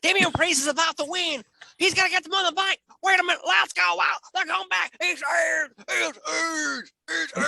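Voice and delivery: high, fast, excited southern commentator; Corey Graves impression. Imitating the commentator calling Edge's return, excited southern accent. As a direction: call the swerve like a hyped announcer, shouting It's Edge. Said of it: "It's definitely Corey Graves".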